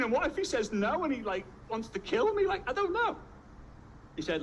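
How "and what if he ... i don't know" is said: rising, hammed-up fright; comic squeaky panic at the end; mock panicked thinking. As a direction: climb higher and higher as the worry runs away. Acts petrified thoughts of being killed.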